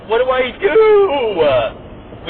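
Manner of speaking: put-on high, drawn-out whiny kid plea; panicked kid voice. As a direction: wail it like a lost little kid. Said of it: "he was like".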